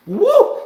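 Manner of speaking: loud high woo; a sudden hyped shout of woo; excited whoop. Real, not acted. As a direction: let out a real whoop. Reacting to smack fights.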